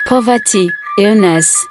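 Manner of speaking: usual speaking voice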